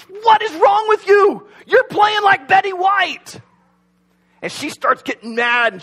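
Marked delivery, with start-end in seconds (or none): 0.0-3.4 loud, high exasperated yell; full-force angry football-huddle yell. Acted. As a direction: yell it at a teammate, pitched way up and drawn out. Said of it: "one of the guys starts yelling at her".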